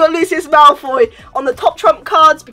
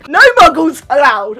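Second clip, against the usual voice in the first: 1 excited shout; 7 dB louder than usual. Real, not acted. Excited start of tour.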